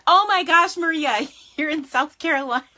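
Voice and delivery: loud, high burst of delight; light up at seeing her; excited surprise. Real, not acted. Host spotting a friend on the live stream, loud and delighted. Spots a viewer who fled the storm.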